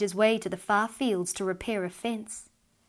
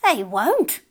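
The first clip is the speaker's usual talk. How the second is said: sharp retort, higher and louder; snapped; 4 semitones higher, 6 dB louder than usual. A narrator's reading. Old woman contradicting her husband, snapping. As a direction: snap back in contradiction. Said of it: "snapped the old woman".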